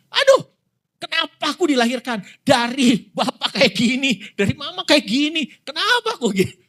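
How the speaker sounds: put-on high, whining child, fed up with his parents; whiny complaining child. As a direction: whine it high like a sulky kid. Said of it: "anak kita gini". (rengekan anak yang mengeluh dengan suara tinggi)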